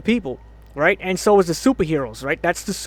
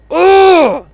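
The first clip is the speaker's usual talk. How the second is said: loud, high-pitched groan; frustrated yell; 11 semitones higher, 11 dB louder than usual. Real, not acted. Fan disgusted by the new logo, yelling in frustration. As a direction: burst out with a wordless "ugh".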